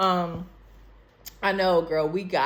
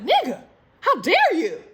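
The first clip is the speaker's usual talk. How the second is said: high, loud indignant cry; outraged yell; 13 semitones higher, 10 dB louder than usual. Retold or imitated. Retelling her reaction to his pic, outraged yell. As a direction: shriek it in mock outrage. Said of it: "I was like".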